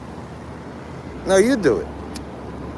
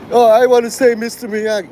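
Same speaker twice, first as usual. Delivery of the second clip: mock-naive quote, pitched up and louder; 5 semitones higher, 4 dB louder than usual. Streamer mocking a viewer who confuses actor and role, mocking imitation. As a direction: imitate a clueless viewer, higher. Mocks someone mistaking who Pat Morita is.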